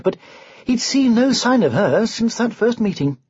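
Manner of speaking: ordinary delivery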